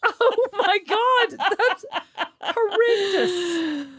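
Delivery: high, slow, laughing through shock; laughing disgust. Real, not acted. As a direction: cry out laughing, appalled. Shocked at crude joke.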